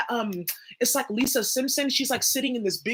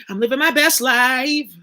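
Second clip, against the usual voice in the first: loud declaration; 2 semitones higher, 8 dB louder, slower than usual. Real, not acted. Declaring her triumph loudly.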